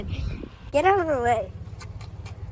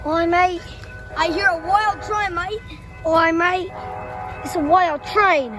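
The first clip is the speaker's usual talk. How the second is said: hammed-up Australian accent; 3 semitones higher than usual. Kid at the crossing shouting about the train in a mock Australian accent. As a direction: play the Aussie bloke spotting a wild train. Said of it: "Oh, you know, Australian".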